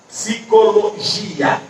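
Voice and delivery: PSICOLOGIA slowly, with GI held apart from A; separated syllables. Shown as a demonstration. Teacher repeating the split for pupils, slow syllable-by-syllable psicologia. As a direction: break psicologia into slow beats, ending gi-a. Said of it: "eu vou separando".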